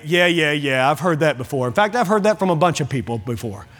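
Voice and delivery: low, soft, bored brush-off; dismissive attitude. Pretend. Preacher imagines God dismissing broken promises, low soft brush-off. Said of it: "have an attitude like we have".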